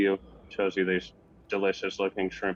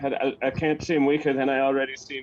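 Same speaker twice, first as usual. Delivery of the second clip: higher, louder and rushed, near breaking; holding back tears; 6 semitones higher, 3 dB louder, faster than usual. Acted. Cabin boy insisting to his captain he is fine, voice near breaking. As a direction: blurt the denial fast, fighting off tears. Bruce denies crying to captain.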